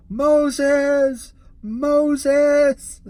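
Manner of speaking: loud high call, repeated; yelling Moses, high and loud; mock crazy yell. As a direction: ham up the crazy guy calling out. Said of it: "crazy guy outside his car with his arms out going".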